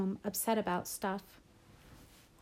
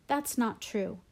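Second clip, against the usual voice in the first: ordinary delivery, nothing marked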